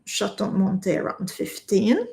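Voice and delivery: ordinary delivery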